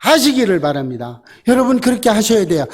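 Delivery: usual speaking voice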